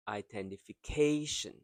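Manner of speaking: "identification" with quick opening, long stressed "ca"; same stress pattern. Shown as a demonstration. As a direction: run up to a held "ca" in "identification". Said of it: "The same as this".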